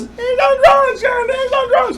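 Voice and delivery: very high, whiny mimicry; mocking accusation. Son mimicking dad telling others he's on drugs, mocking wail. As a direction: parody the accuser in a squealing wail.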